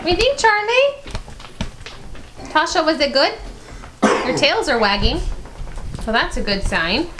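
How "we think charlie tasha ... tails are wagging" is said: high, sing-song voice for the dogs; talking to a dog. Real, not acted. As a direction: coo at the pets. Asking the dogs about the treats.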